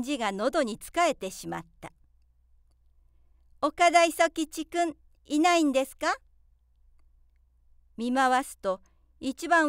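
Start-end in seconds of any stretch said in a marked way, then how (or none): 3.6-6.2 teacher calling roll. A narrator's reading. Young teacher calling roll to first graders. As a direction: call the pupil's name across the room.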